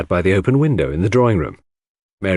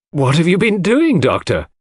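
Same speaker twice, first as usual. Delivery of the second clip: startled cry, higher and a bit louder; 8 semitones higher, 3 dB louder than usual. A narrator's reading. Mrs Cavendish crying out at the doctor covered in mud. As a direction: call out at the muddy doctor. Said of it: "cried Missus Cavendish".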